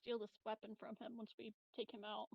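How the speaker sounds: normal talking voice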